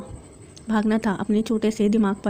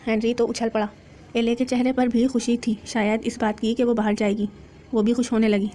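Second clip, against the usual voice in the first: plain reading voice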